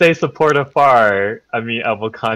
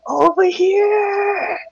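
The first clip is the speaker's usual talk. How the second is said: drawn-out high hushed call; slow, strained hushed shout; whisper yelling; much slower than usual. Acted. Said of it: "Kinda like, kinda like whisper yelling".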